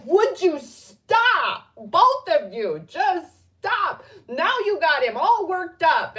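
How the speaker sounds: loud, high mock scolding with drawn-out words; hammed-up telling-off; imitating exasperated friend. Friend scolding her and the excited dog, mock exasperated. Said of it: "she would just be like".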